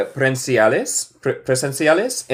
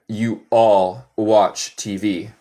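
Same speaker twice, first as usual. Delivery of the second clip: slow model sentence; much slower than usual. Shown as a demonstration. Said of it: "teacher models slow sentence".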